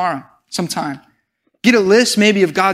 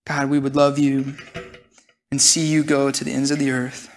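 closing prayer; 4 semitones lower than usual. Real, not acted. Preacher praying to God at close of sermon, hushed prayer. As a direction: speak humbly to God, with long pauses.

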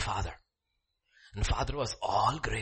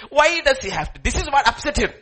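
high, loud, laughing protest; laughing mock outrage; 13 semitones higher, 8 dB louder than usual. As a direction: laugh through the indignation. Voicing indignant elder brother.

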